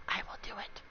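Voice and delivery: very soft, low whisper; hushed, menacing promise; whispered threat. Real, not acted. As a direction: whisper it as a warning. Answering a dare.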